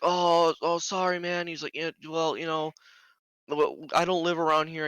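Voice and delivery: a hint of sheepish excuse-making; flustered apologetic driver. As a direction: lightly voice a man fumbling an apology.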